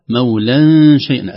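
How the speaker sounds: مولا شيئا with the n held as a nasal hum; hidden tanween. Shown as a demonstration. Teacher contrasting the second word, hidden n in مولا شيئا. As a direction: blur the n of مولا into the sh. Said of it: "نون التنوين مخفاة عند الشين".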